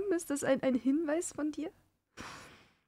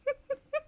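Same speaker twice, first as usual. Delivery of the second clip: scream; 12 semitones higher, 4 dB quieter than usual. Real, not acted. Horror game jump scare.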